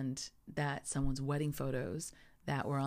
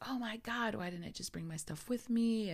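mild, higher-pitched mock panic; retold panic; 8 semitones higher than usual. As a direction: replay a flustered self-reproach, starting high. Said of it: "it was panic mode".

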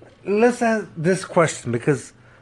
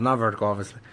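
ordinary talk

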